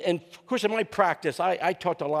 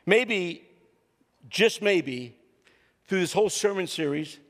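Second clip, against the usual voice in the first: normal talking voice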